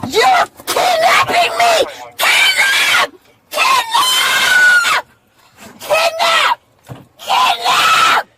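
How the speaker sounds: high desperate screams for help; screaming. Real, not acted. Screaming during forced arrest.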